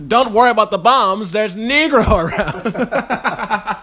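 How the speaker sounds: put-on old-timey voice, slowing and laughing; laughing 1940s radio voice. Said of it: "the quintessential fucking old-timey voice".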